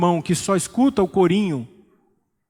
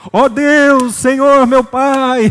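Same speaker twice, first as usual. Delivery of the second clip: imitating a loud booming prayer, much higher and louder; 8 semitones higher, 9 dB louder, much slower than usual. Father-in-law praying alone in his room, loud imitated prayer. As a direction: belt it out like praying to be heard through the wall. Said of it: "Eu gosto de orar alto".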